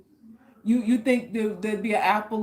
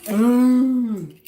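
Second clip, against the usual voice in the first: tipsy ooh; 3 semitones higher, 10 dB louder than usual. Retold or imitated. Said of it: "I'd be like".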